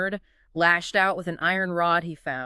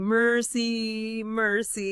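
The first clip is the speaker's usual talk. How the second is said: higher, drawn-out mock plea; imitated scream for mercy; 5 semitones higher, much slower than usual. Griffin begging the crowd one last time, imitated scream. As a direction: cry it out as a restrained mock scream.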